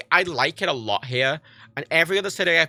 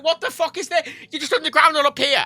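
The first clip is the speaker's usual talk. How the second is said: high-pitched astonished outburst; amazed; 10 semitones higher than usual. Retold or imitated. Tourist finding a pier underground, amazed. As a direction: exclaim it high, in acted disbelief.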